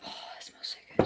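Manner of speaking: breathy whisper, savoring the scent; savoring a smell. Real, not acted. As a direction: breathe it out softly, sniffing the candle.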